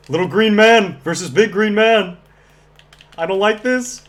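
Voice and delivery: high, loud nervous excitement; excited. Real, not acted. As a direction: shout it with gamer panic. Yoda versus Chewbacca fight.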